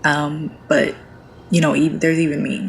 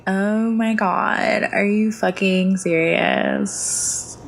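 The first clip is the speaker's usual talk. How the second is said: put-on drawn-out, higher-pitched valley girl voice; 5 semitones higher, much slower than usual. Shown as a demonstration. Streamer demonstrating her gaming alter ego on request, valley girl drawl. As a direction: stretch it out like an incredulous valley girl. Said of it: "show me the Valley Girl".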